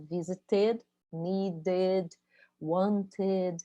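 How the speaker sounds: visited, needed, wanted each end in a full ed syllable. Shown as a demonstration. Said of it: "a gente tem que pronunciar o E".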